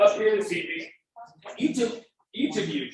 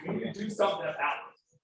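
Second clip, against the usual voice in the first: getting emotional; 8 dB quieter than usual. Real, not acted. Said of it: "I'm sorry I'm getting emotional".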